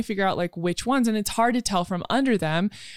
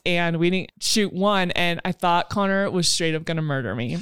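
ordinary talk, nothing marked

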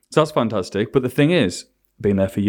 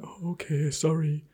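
small, soft apology; meek apology; 6 semitones higher, 10 dB quieter, slower than usual. Retold or imitated. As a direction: mumble a timid sorry. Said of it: "maybe I just went".

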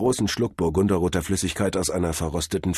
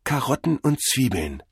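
obedient repeating; 6 semitones higher than usual. A narrator's reading. Cordell repeats the god's lesson, subdued echo. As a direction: echo it like a dazed pupil. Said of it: "wiederholte Cordell".

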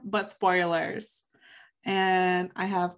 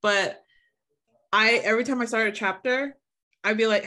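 normal talking voice

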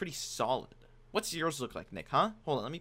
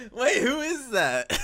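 laughing disbelief; 12 semitones higher, 10 dB louder, much slower than usual. Real, not acted. Sees drawing revealed, laughing.

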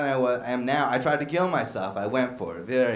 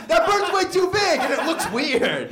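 high, loud comic protest; mock outraged shout; 13 semitones higher, 4 dB louder than usual. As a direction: shout it as an appalled bird fan. Bird enthusiast's protest builds.

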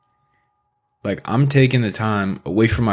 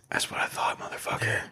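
smug whisper; 12 dB quieter than usual. Retold or imitated. Imagined smug reply to a hater.

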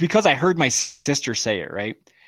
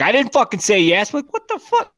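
indignant mom outburst, louder and higher; exasperated retold protest; mock angry mom; 9 semitones higher, 4 dB louder than usual. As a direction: imitate an outraged mom, pitch climbing. Acting out his angry mother.

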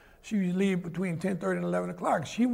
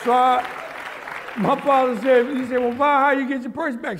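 much louder and higher, bursting with laughter; laughing hard; 5 semitones higher, 7 dB louder than usual. Real, not acted. Man laughing as he retells his father asking mother, loud. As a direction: crack up while quoting Dad.

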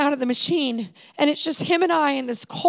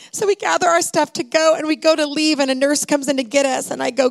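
usual speaking voice

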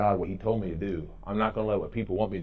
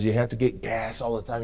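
ordinary talk, nothing marked

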